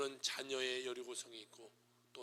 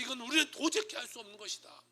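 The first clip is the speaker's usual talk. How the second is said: loud, high admission of helplessness; acted loud outcry; crying out; 8 semitones higher, 10 dB louder than usual. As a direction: call it out with the pitch raised. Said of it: "그러면서 확 하고 외치자".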